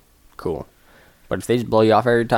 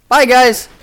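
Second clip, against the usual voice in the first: excited sign-off; 9 dB louder than usual. Real, not acted. Cheerful sign-off to viewers.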